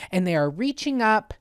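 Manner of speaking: usual speaking voice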